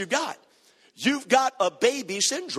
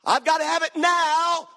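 high-pitched mock impatient child; childish demand; 8 semitones higher, 3 dB louder than usual. Preacher mimicking people who want needs met immediately, childish demand. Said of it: "That's childish".

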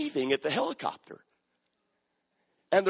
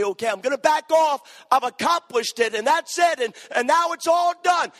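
higher, louder mocking a quitter; 10 semitones higher, 7 dB louder than usual. Preacher mocking a believer giving up, loud and high. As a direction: ham up a lazy quitter, rising.

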